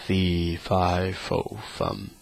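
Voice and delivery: a hint of a low ogre chant; lightly voiced ogre. A narrator's reading. Ogre sniffing out Jack, a lightly low chant. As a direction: drop down into a mild ogre rumble. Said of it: "cried out the ogre".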